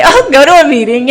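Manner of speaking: laughing mock sponsor. Mocking sponsor's stock answer.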